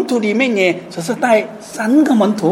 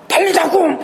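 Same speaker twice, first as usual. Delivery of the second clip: mock loud call; 7 semitones higher than usual. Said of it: "很大声来".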